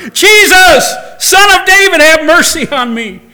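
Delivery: a blind man shouting over the crowd. Retold or imitated. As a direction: yell it out with everything. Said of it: "And he yelled even louder".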